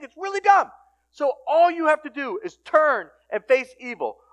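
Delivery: high, insistent sermon voice; impassioned preaching. Acted. Preacher telling congregation evil is dumb, impassioned. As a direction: drive the point home with rising force.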